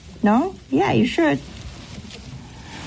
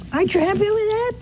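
much higher, fast disbelieving question; incredulous question; 11 semitones higher, much faster than usual. Retold or imitated. Priest pressing the bitter widow, high and incredulous. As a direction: push it up in disbelief.

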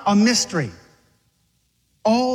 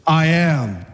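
low, assured declaration; man swagger; 4 semitones lower than usual. Acted. Jesus answering those come to arrest him, low swagger. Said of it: "He had the man swagger too".

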